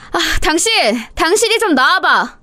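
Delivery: high-pitched call to the husband; exasperated calling out. Acted. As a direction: summon your husband for help, fed up. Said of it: "자기 아들을 이렇게 부른 것이". (답답해서 높게 부르는 소리)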